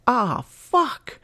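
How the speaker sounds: high sudden cry of pain and curse; pained outburst. Acted. Dylan losing control under her fingernails, high pained cry. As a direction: break silence with a yelping curse. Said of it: "keeping quiet was not an option".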